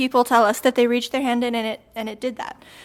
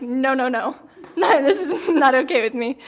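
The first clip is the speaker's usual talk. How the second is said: nervous laugh through the words; laughing protest. Retold or imitated. Babysitter laughing at her own fright, laughing. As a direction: laugh while refusing, half scared. Reacting to a stranger at the door.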